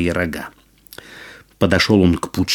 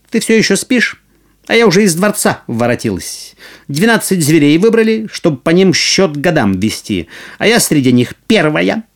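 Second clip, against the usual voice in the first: boastful mouse; 7 semitones higher than usual. Acted. Proud mouse boasts to cat.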